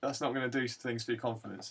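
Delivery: ordinary talk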